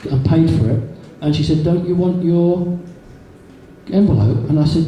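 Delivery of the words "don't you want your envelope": shop assistant. Retold or imitated.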